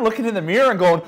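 long breathy sigh at the mirror; exasperated sigh. Retold or imitated. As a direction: let out a long weary sigh.

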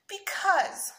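put-on high, loud little-boy pleading; mildly acted sniffly kid; whiny child making his case. A narrator's reading. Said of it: "sniffed Sammy".

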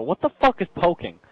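high-pitched, baffled exclamation; retold bewilderment. Gamer recalling his first day on Facebook, retold bewilderment. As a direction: act out your confused reaction, pitched well up. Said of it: "I go".

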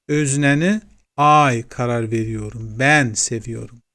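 ordinary talk

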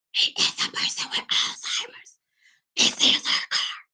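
hushed whisper to the owner; whispered explanation. Retold or imitated. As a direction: whisper the excuse.

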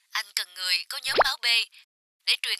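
quiet, hurried voice down the line; an urgent call heard through a phone; over the phone. Acted. Caller from the hospital rushing the request over the phone.

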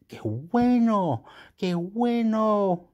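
high, very drawn-out words of approval; acted delight. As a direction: stretch it out slowly in imagined delight. Voicing the listener's delighted reaction.

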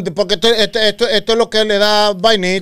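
teasing mimicry of his friend; mocking. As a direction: poke fun at him in a put-on voice. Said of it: "déjame burlarme".

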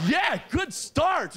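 high, very slow, savoring it; slow pleased drawl. Retold or imitated. Reacting to the bouncer's slap, slow high delight. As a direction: stretch it out, delighted.

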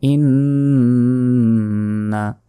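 long hummed n in ইন্না; very drawn-out nasal ইন্না; ghunnah nasal hold. Shown as a demonstration. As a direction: hold the n of ইন্না through the nose. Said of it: "ইন গুণা হবে".

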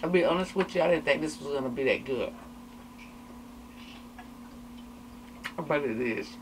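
chewing while talking; speaking through food; mouth full of chicken. Real, not acted.

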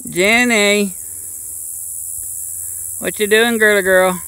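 high, loud coaxing call; calling to animal. Real, not acted. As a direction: sing it out to the donkey. Calling to donkey.

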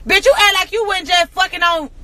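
ordinary delivery

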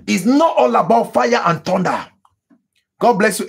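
ordinary delivery

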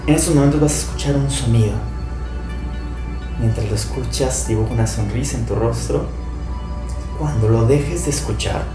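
calm guided meditation voice; right in your ear. Acted. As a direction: guide them gently into stillness.

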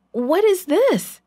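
alarmed cry, high and slow; a brother finds money in his sack, high startled call; surprised call. A narrator's reading. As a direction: gasp it out, high and drawn out, at the open sack. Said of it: "he called to the others".

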